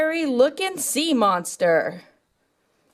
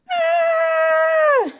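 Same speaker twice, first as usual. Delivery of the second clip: pretend scream; 13 semitones higher than usual. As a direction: cry out as you're hit. Revit attacked by monster.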